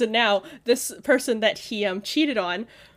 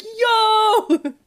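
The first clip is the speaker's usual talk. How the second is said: high, loud delighted yelp; mock excited yo; 10 semitones higher, 6 dB louder than usual. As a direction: yell it like he just spotted cricket. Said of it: "he's like".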